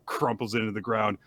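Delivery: ordinary delivery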